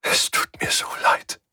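broken whisper through tears; tearful whisper. Acted. Svensson, hand burned, whispers her apology through tears. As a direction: whisper it, all cried out.

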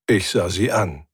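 usual speaking voice

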